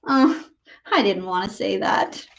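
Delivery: plain reading voice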